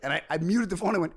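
normal talking voice

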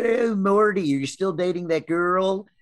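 lightly hammed-up Baltimore accent, drawn out; playful Baltimore family impression. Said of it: "or whatever the fuck you guys say".

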